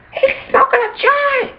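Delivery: very high falsetto yell; mock scream. Screaming out the devil's joint smoking, very high mock yell. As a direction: squeal it out like a tattling child. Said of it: "you start screaming".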